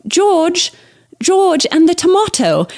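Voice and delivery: put-on a toddler's British accent, lisped and slow; imitating toddler's British accent. Mother imitates her daughter's British accent from TV, high and slow. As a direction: mimic a little girl's British accent. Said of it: "she was using a British accent".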